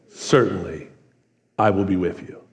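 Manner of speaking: low, soft reassuring God. Acted. Preacher voicing God, softly and low. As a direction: give the promise gently and low. God's reply voiced in sermon.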